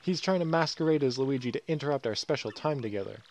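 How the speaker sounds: high squeal of disgust, easing into complaint; creeped-out fan. Acted. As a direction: recoil loudly from a creepy lookalike. Fan recoils from lookalike.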